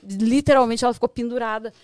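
ordinary delivery

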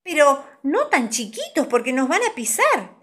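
put-on high, loud child's protest; worried. A narrator's reading. Pinta afraid of being stepped on while tiny, worried. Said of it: "se preocupó Pinta".

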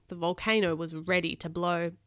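usual speaking voice

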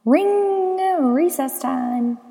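high, loud, ringing "Ring!"; excited bell call. A narrator's reading. Narrator mimics the bell for recess, high and excited. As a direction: call out recess with drawn-out words.

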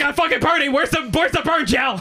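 high urgent half-laughing cry; frantic plea. Retold or imitated. Burned cook to manager, frantic high plea. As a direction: demand the gel in a panic. Asking manager for burn gel.

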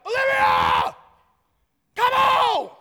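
full-throated, drawn-out high shout down the street; screaming dad. Retold or imitated. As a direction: holler for your kid at the top of your lungs. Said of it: "I scream at the top of my lungs".

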